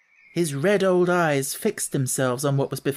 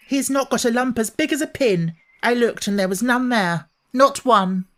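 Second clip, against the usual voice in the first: put-on high, raised indignant girl; fierce indignation; 8 semitones higher, 4 dB louder than usual. Mary indignantly shouting up to Ben, high. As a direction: nearly shout it up the wall. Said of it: "almost shouting ... in her fierce indignation".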